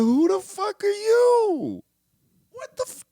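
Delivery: shocked viewer meeting Morpheus; incredulous outburst. Retold or imitated. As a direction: squeal it in disbelief. Retold reaction to Morpheus.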